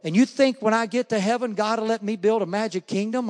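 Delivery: high, loud excited holler; hollered question. Retold or imitated. Girl hollering to Mister Knowles about heaven, loud and excited. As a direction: call out across the crowd, excited. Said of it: "hollered at me".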